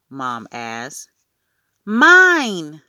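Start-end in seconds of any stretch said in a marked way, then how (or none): 1.8-2.8 high, loud gleeful cry; gleeful little boy. A narrator's reading. Toddler Fudge owning up to his mother, gleeful. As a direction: crow it out like a pleased toddler. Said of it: "Fudge laughed".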